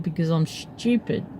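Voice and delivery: soft, under-the-voice retort; quiet mumbled "stupid". Retold or imitated. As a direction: admit it quietly, half to yourself. Repeats answer after being asked what.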